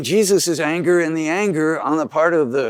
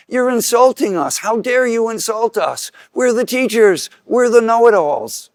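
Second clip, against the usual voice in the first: much higher and louder, indignant fury; shrill with wounded pride; outraged Pharisees; 8 semitones higher, 5 dB louder than usual. Retold or imitated. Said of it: "the scribes and Pharisees that say".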